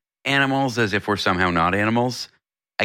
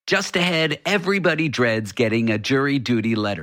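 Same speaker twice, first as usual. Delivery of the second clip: higher-pitched announcer's tease; radio teaser read; 6 semitones higher than usual. Real, not acted. Host teasing the next story after the break, radio teaser. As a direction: sell the upcoming segment to listeners.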